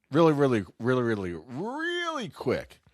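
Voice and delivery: really, really, really quick, the last soaring up; stretched really quick. Acted.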